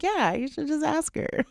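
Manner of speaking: high, sheepish echo of the advice; sheepish agreement. Retold or imitated. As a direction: concede it with a squeaky, guilty grin. Sheepishly echoing husband.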